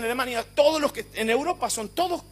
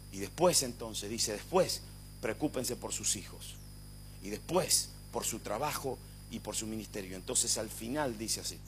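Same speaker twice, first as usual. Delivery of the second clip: low, soft pulpit voice with long pauses; hushed preaching; 10 semitones lower, 9 dB quieter than usual. Acted. Preacher closing with Paul's priorities for the congregation, hushed and solemn. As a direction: lay it out quietly and deliberately, pitched well down.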